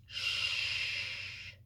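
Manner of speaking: breathy finger-to-lips shush; soft shush. Acted. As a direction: hush the listener secretly. Gorilla hushing with finger.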